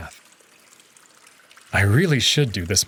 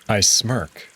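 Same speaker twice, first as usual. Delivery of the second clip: ordinary delivery, nothing marked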